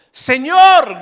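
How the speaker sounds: loud high cry of Señor; shouting. Retold or imitated. Disciples in the storm cry out loud and high. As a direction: call out in panic.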